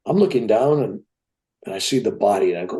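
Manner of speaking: usual speaking voice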